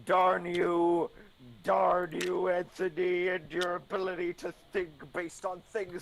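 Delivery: overdramatic cartoon villain; sputtering foiled villain. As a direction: shake a fist at the hero, hammed up. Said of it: "Over dramatic cartoon villain impression".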